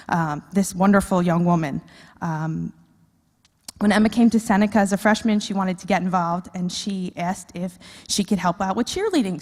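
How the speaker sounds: normal talking voice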